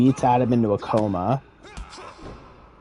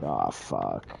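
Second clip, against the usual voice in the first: very low, hushed mock dismay; sinking regret; 8 dB quieter than usual. Storyteller voicing a father realizing what he did, sinking regret. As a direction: let it drop low and soft, as if caught out.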